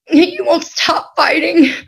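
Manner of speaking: high, tearful, slow and pleading; crying. Real, not acted. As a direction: voice breaking into tears. Voicing son's plea, crying.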